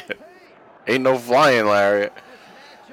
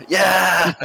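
excited cheer; 5 semitones higher than usual. Real, not acted. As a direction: cheer like a fan at ringside. Cheering the wrestler on.

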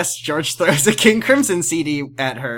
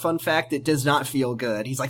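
usual speaking voice

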